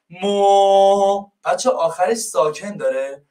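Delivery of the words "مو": مو drawn out and held; stretched مو; held syllable. Shown as a demonstration. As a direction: hold مو long to show it ends open. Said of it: "بخش اول مسافرت هست مو".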